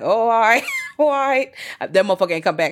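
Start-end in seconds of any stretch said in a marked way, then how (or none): 0.0-1.5 high, loud, laughing through the words; laughing nervous reply. Retold or imitated. To Devon's threat, a loud laughing all right. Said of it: "I was like".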